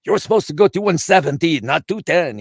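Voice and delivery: high, loud retold scolding; upset track owner. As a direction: scold a reckless driver, imitating the angry owner. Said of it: "got really upset with me".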